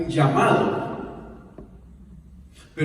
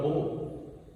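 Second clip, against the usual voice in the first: a lightly voiced helpless cry, lower and softer; mock despair, pitched lower and soft; Chapulín Colorado's cry; 4 semitones lower, 8 dB quieter, slower than usual. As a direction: borrow the TV hero's famous plea, hushed and lower. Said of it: "como dijo el Chapulín Colorado".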